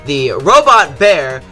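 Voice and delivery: normal talking voice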